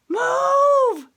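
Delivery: very high, very loud command; shout. Acted. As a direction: bellow it at the boy. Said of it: "they shouted".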